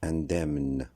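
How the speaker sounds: indemne with both M and N, in-dèm-n; correct pronunciation. Shown as a demonstration. Teacher showing the right form, indemne with M and N. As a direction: finish indemne on a clear M-N cluster. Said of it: "La bonne prononciation correcte".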